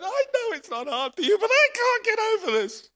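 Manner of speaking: high, pleading cry to God; tearful plea. Retold or imitated. Preacher retelling his tearful prayer on the road. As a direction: plead through tears. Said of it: "tears are streaming down my face".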